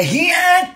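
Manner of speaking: high martial arts battle cry; "Hiat" shouted high like a kung fu yell; power up scream. Acted. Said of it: "Biar Bunda pantau dengan ilmu gue". (teriakan jurus "Hiat" melengking)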